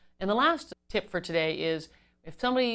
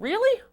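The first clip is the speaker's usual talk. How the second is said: "really" shooting sharply upward, louder; questioning really; 7 dB louder than usual. Shown as a demonstration. Agent doubting a customer's word, "really" as a high rising question. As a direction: put a big question mark on "really". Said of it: "put a big question mark at the end".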